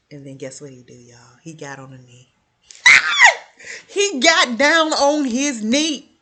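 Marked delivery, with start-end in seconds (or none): 2.7-3.8 very high shrieking burst of laughter. Real, not acted. Viewers hearing of the proposal, shrieking delighted laugh. 3.9-6.1 loud, slow, high excited shout. Real, not acted. Telling viewers about the proposal, slow excited shout.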